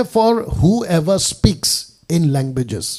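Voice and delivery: slow, deliberate English scripture reading. Acted. Preacher reading a Bible translation to listeners, slow and emphatic. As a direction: read it out very slowly, word by word.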